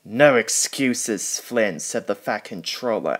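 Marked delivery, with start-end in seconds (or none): 0.0-1.8 slow, loud, cross boss; stern scolding. A narrator's reading. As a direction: lay down the law slowly.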